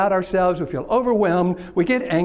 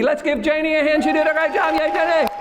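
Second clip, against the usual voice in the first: high-pitched, rallying cheer; hearty game-show style hurrah; 10 semitones higher than usual. Real, not acted. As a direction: whip up applause for a volunteer. Rallying applause for a volunteer.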